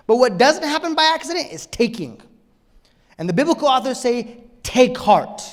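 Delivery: loud, high, urgent preacher; full-force preaching. Real, not acted.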